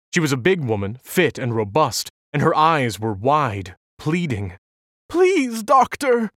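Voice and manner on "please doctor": high, loud, tearful begging; sobbing plea. A narrator's reading. Alice begging the doctor for her baby, loud high sob. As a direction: cry it out, pleading through tears. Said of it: "she sobbed".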